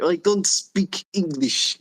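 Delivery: slow, high mock broken English; hammed-up broken English, drawn out. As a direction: lay on a clumsy foreign accent, slowly. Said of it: "more like a broken English".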